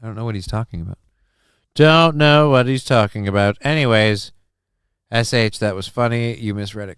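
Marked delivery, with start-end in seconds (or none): 0.0-1.0 softer, offhand aside; quiet denial. Pretend. Streamer brushing off a chat jab about impressions, quiet denial. Denial muttered, then repeated louder. 1.8-3.6 louder, emphatic repeat; loud denial. Pretend. Streamer publicly denying a chat jab about impressions, loud denial.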